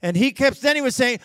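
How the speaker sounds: usual speaking voice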